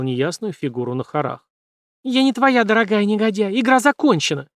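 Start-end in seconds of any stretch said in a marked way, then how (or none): 2.0-4.5 high, loud defiant outburst; defiant shout. A narrator's reading. Jane defying the villain, high defiant shout. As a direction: fling it up at him.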